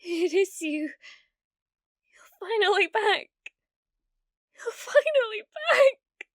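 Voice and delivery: rising to an overwhelmed, slowed cry; overjoyed crying shout. Acted. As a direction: burst out with tearful joy. Overjoyed viewer returned.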